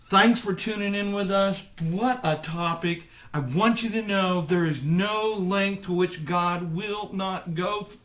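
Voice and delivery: earnest preacher wrapping up the show; preacher's closing address. Acted. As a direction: deliver a rousing closing message of hope. Delivers the show's closing.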